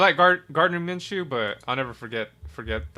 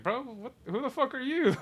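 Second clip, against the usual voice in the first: mock outrage, pitched way up; retold incredulous retort; 11 semitones higher than usual. Retelling his answer to a trash-talker, incredulous.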